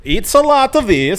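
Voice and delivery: put-on high sing-song Norwegian accent; lilting mock Norwegian accent. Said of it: "fake a Norwegian accent".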